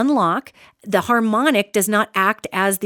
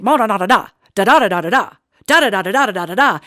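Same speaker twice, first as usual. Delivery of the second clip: loud, monotone news drone; authoritative newsreader drone; 4 dB louder, faster than usual. Shown as a demonstration. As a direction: drone it like the voice in the talking box. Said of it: "the authoritative voice in the talking box".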